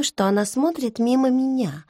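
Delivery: ordinary delivery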